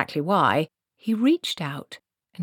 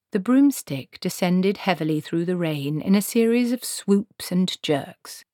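ordinary delivery

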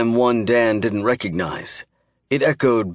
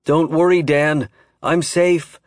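higher, louder distant call; calling from far away; 6 semitones higher, 3 dB louder, slower than usual. A narrator's reading. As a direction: shout it down a bad line. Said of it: "Billy called, still distant".

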